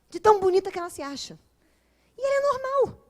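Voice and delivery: exasperated punchline. Real, not acted. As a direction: hit the punchline up high. Punchline on how she looks.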